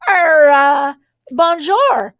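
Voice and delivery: a nervous mock French greeting; best French accent. Girl bluffing villains with a French accent. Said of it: "in my best French accent".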